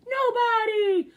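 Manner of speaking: high, slow, stretched "Nobody" answer; high slow hype call. Acted. Hyping his own advice to viewers, high and drawn out.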